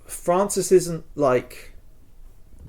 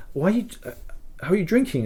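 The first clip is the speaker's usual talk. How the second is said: higher, softer, puzzled alarm; retold alarmed question; 7 semitones higher, 5 dB quieter, much faster than usual. [name] questioning drinking Francis, higher and alarmed. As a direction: ask like you can't believe what you're seeing. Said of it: "and I was going, like".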